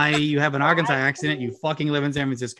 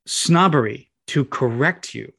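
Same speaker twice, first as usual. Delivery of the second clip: usual speaking voice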